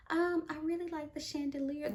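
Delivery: quiet, sly mock compliment; shady compliment. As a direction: throw shade with a fake sweet tone. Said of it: "throwing that shade like".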